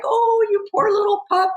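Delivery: high cooing voice to a puppy; baby talk. Retold or imitated. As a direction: coo it like to a baby animal.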